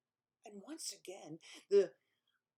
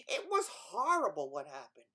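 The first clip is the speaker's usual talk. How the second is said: usual speaking voice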